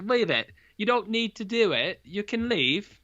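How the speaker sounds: pleading in a hammed-up New Zealand accent. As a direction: girlfriend begging him to leave, in a mock New Zealand accent. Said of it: "This is my New Zealand accent".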